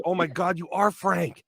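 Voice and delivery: retold high-pitched astonishment; amazed reaction. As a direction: imitate a friend floored by the discovery.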